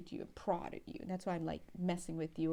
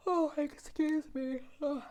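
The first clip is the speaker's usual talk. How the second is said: speaking through a yawn; words stretched by a yawn; 7 semitones higher, 6 dB louder than usual. Real, not acted. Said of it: "If you don't mind my yawning".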